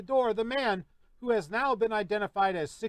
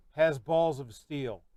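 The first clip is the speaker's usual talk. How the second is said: has balls of steel drawn out slowly; weight each word of balls of steel; slow emphasis; 4 semitones lower, slower than usual. Real, not acted. Verdict on Parrish.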